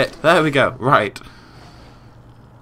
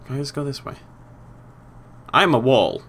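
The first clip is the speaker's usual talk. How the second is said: usual speaking voice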